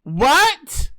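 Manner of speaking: high, loud incredulous what; stunned what. Real, not acted. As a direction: cry out in disbelief. Reacts to seventies claim.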